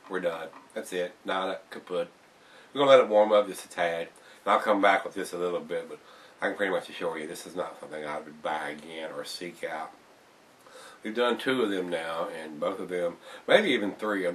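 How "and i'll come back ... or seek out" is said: usual speaking voice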